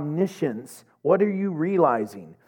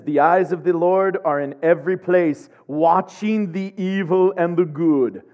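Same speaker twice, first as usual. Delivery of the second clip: ominous reading; 4 dB louder than usual. Shown as a demonstration. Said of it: "I read it like that".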